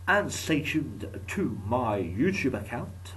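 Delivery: slow, sing-song outro line; slow drawn-out sign-off. Acted. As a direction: stretch out the plug slowly.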